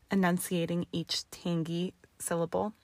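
ordinary delivery, nothing marked

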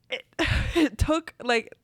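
usual speaking voice

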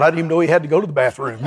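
ordinary talk, nothing marked

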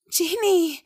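high breathless call of a friend's name; gasping cry. A narrator's reading. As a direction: gasp her name and rush in. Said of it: "keuchte sie".

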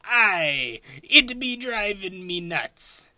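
high, slow hammy pirate. Retold or imitated. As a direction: ham up a pirate growl. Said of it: "And the pirate goes".

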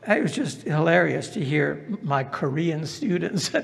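normal talking voice

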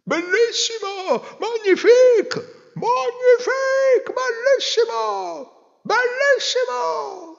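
very high, slow drawn-out cheers; ovation. Acted. Crowd acclaiming the emperor, high drawn-out cheering. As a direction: cheer and rave. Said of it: "tra applausi ed ovazioni".

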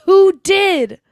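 high, loud imitated outrage; mock outraged demand. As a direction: demand it loudly as the hammed-up accuser. Acting out Annie's furious demand.

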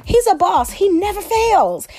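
high, excited praise; excited gushing. Real, not acted.

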